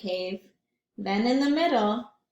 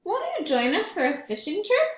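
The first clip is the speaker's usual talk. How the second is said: higher and quick, cheerful friends calling in; friendly invitation; 7 semitones higher, 4 dB louder, faster than usual. Acted. Bear's friends invite him on a fishing trip, cheerfully. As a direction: call brightly from outside the cave. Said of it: "friends asked".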